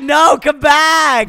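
loud slow call, no come back; shouting after him. Real, not acted. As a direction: yell for him to return. Calling friend to come back.

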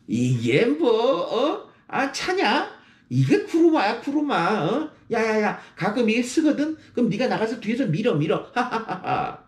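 높은 톤으로 능청스럽게 인정하는 농담; 내 차를 스스로 놀리며 너스레; joking self-mockery. Shown as a demonstration. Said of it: "그렇게 말할 수 있는 당신". (차를 놀린 친구에게 웃으며 맞받아치는 능청)

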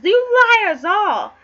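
loud, drawn-out acted shout; angry accusation. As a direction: roar it at the whole court. King accuses all his lords.

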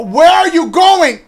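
loud, high slow yell; alarmed gamer shout. Real, not acted. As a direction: call out in alarm at the character. Yelling at character.

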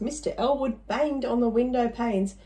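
ordinary delivery

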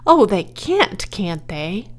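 usual speaking voice

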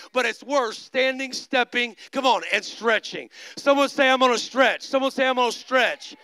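rally the congregation into a response; rousing preaching. Real, not acted. Preaches at full force, rallying congregation.

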